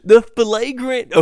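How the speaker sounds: laughing through the words; can't stop laughing mid-word. Real, not acted. Laughing rant about mispronunciation.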